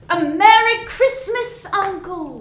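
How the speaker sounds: loud, high joyful call; jolly nephew greeting; cheery greeting. Acted. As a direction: burst in full of cheer.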